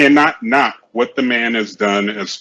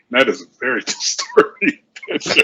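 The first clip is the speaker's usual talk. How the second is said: laughing; 9 semitones higher than usual. Real, not acted. Laughing at a disturbing photo.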